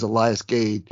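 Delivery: Elias as ee-LYE-us; long-i pronunciation. Shown as a demonstration. Host insisting on his own version, long-i pronunciation. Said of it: "when I say it".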